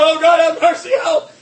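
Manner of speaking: high, loud panicked cry for mercy; terrified cry. Retold or imitated. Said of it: "He cried out".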